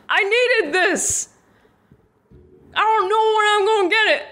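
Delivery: pleading defense. Acted. Defending herself to friends.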